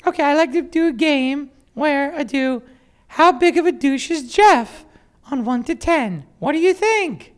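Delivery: very high, loud, slow mock English Kelly voice; English accent Kelly. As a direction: keep up the squeaky English Kelly bit. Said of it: "I'll pretend I'm Kelly".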